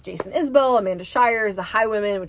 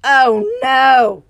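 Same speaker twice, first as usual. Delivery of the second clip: groaned; 4 semitones higher, 10 dB louder than usual. Acted. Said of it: "groaned Willie under his breath".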